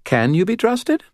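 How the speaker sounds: high, playful questioning lilt; whimsical child. A narrator's reading. Girl teasing about her driver, whimsical and high. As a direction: tease with the name. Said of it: "her voice whimsical".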